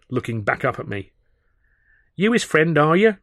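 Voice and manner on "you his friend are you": put-on high cheeky street kid; street urchin voice. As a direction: play a cocky London urchin. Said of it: "a raggedy street urchin".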